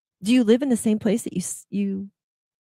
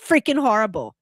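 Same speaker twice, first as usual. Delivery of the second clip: louder, climbing higher to a near yell on the last why; angry rant; 5 semitones higher, 4 dB louder than usual. Acted. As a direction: vent at the VA, building to exasperation. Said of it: "it could be against the V A".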